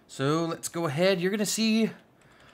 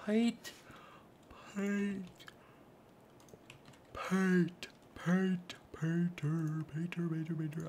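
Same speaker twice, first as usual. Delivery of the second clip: odd sing-song voice; 2 semitones higher, 7 dB quieter, slower than usual. Pretend. Calling for the painter in a lightly silly voice. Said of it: "I don't know why I'm talking like that".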